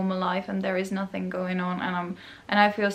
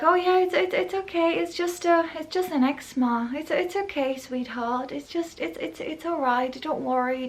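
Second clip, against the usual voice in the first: put-on much higher, much softer baby-talk reassurance; sugary patronizing doctor; 5 semitones higher, 4 dB quieter than usual. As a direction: talk down to a child with fake sweetness. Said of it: "they were like".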